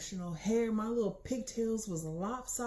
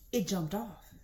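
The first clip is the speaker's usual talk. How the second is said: normal talking voice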